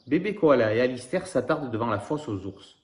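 ordinary delivery

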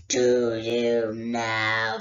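low, slow menacing dragon voice; a great dragon toying with a thief; dragon Smaug. Acted. Smaug answers Bilbo.

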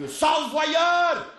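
high, slow outraged yell; mock-furious insult; vexed man shouting; angry shout. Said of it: "Il criait".

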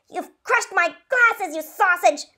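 mother scolding daughter over glasses, thundering. A narrator's reading. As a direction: bellow at her clumsy daughter. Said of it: "Mama thundered".

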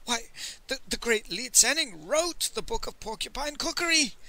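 shocked merchant, voice lifted; gasped, aghast. A narrator's reading. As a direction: stammer it in awed disbelief. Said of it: "the merchant gasped".

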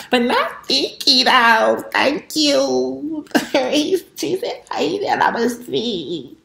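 high, stretched squeal of mock offense; mock outrage. To Keith about the nickname Thicky, high mock-offended squeal. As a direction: squeal it like you're offended but loving it.

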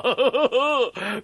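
high, gloating ogre laugh; villain's laugh. Acted. Puppeteer relishing a fire for his roast, wicked laugh. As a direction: laugh wickedly over the threat.

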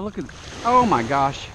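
normal talking voice

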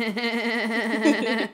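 imitated forced laugh, evenly repeated; badly faked stage laugh; fake laugh. Actor who doesn't laugh attempting one, fake laugh. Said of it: "Try a real laugh".

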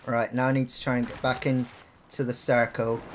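ordinary talk, nothing marked

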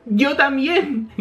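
high, drawn-out character voice; Usopp. Acted. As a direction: stretch it out in a high voice. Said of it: "comenta Usopp".

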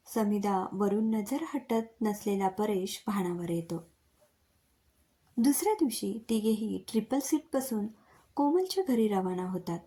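ordinary delivery

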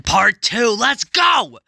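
loud, high hyped-up shout; really excited. Real, not acted. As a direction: kick it off with a rising, excited yell. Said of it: "I'm just really excited".

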